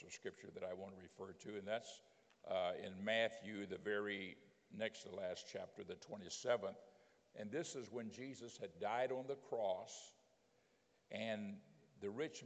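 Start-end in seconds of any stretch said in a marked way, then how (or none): none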